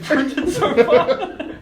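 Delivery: high, loud, drawn-out laughing question. Real, not acted. Player asks about goblin newspapers, laughing loudly. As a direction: crack up while asking.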